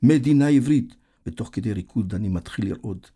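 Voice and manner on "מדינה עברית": high, loud slogan chant; rallying cry, repeated with raised pitch; shouting. A narrator's reading. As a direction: chant it like a crowd. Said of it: "וצועקים".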